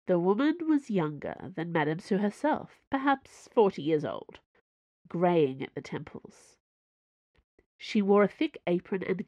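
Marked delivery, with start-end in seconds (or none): none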